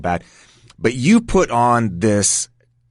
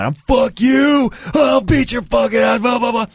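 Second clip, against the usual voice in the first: angry yelling; 13 semitones higher than usual. Retold or imitated. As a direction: go off on him, full rage. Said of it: "just yelling".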